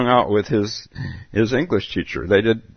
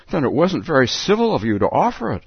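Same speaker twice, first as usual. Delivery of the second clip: high, mildly angry rebuke; Alice rebuking the Hare, mildly angry; 7 semitones higher than usual. Acted. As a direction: scold them, a touch cross. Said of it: "said Alice angrily".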